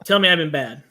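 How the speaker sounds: low, deadpan mock doctor; professional tone. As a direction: keep the dirty request businesslike. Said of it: "saying it in a professional tone".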